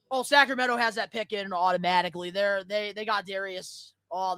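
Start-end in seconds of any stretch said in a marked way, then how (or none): none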